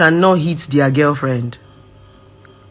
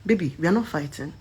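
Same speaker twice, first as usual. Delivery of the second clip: much softer, gentle and steady; calm soft voice; 3 semitones lower, 12 dB quieter than usual. Retold or imitated. Partner calming his shouting girlfriend, soft and even. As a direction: soothe her down without raising it. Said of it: "he'll tell me".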